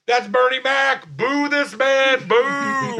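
loud, high heckler's shout; mock heckling. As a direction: boo him from the crowd. Imagined audience member heckling the thief.

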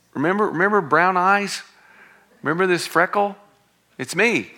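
mock a Jesus who proves himself by freckles. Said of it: "Does he say".

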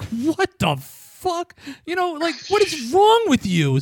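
high-pitched, drawn-out disbelief; incredulous outrage. Retold or imitated. Teller's outraged reaction to cashier.

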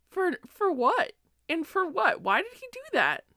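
high, loud disbelieving cry; shocked outrage. Retold or imitated. Reader reliving shock at Patroclus's betrayal, shocked high cry. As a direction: shriek your disbelief at the page. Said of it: "I was so just taken aback".

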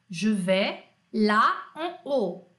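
word by word, drawn out; slow French model. Shown as a demonstration. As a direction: separate each French word for learners. Teacher models translation word by word.

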